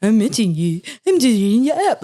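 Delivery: muttering a mock version of his line. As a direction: parrot him back in a silly put-on voice. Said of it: "saying that in that voice to myself".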